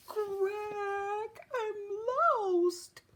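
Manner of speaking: high, drawn-out duckling wail; little small voice. A narrator's reading. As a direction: wail like a tiny lost duckling. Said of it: "a little small voice".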